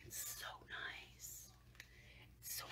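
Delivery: breathless whisper, slow; barely able to speak from awe; awed whisper. Real, not acted. Overwhelmed by the palette, awed whisper. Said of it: "I can't even say it".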